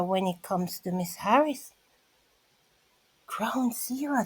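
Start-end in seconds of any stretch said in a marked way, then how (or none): 3.3-4.2 hushed repeat of the phrase; whispered echo. Real, not acted. Whispering to the viewers for effect. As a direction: breathe the words out.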